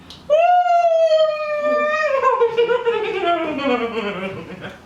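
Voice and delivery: long high shriek of laughter. Real, not acted. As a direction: laugh hysterically, high and loud.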